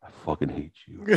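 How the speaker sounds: muttered grudge. Retold or imitated. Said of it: "He's like".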